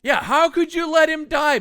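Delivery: high, loud scolding; shrill scolding uncle. Retold or imitated. Dying uncle yelling at his nephew, shrill scolding. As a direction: scold him like a furious old man. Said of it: "And it's just like".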